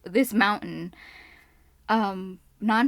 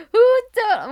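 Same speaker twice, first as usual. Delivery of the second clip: startled yell; 13 semitones higher, 9 dB louder than usual. Retold or imitated. Startled curse at a mountain lion.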